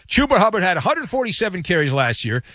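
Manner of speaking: ordinary delivery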